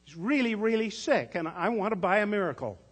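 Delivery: put-on a small girl insisting on her point; little girl pleading. A narrator's reading. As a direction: Tess begging the pharmacist for a miracle. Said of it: "Tess answered in the same annoyed tone".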